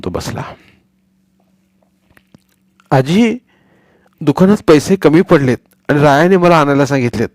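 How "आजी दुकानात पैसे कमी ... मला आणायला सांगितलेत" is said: put-on a lightly raised, eager boy's voice; a fibbing boy playing innocent; boy Sonya. Boy Sonya lying to grandmother for money, eager.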